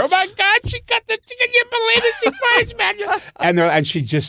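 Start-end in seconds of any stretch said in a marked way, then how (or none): 0.0-2.9 much higher, mock scandalized crowd voice; mock audience gasp. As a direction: squeal like a giggling shocked audience. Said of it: "Now they're".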